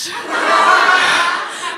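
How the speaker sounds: a bright burst of laughter at the punchline; real laugh. Real, not acted. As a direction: laugh at your own story.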